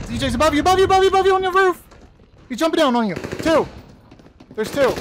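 fast, high shouted warning; urgent callout. Real, not acted. Calling out enemy positions.

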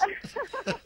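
sudden high burst of laughter. Real, not acted. Host laughs at the mix-up.

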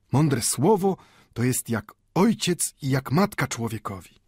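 put-on high, sing-song old man's voice; old Mendel fervent. A narrator's reading.

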